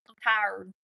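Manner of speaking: drawled Southern tired; Southern accent. Shown as a demonstration. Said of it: "Like they say in the South".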